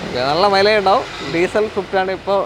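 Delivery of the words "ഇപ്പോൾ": normal talking voice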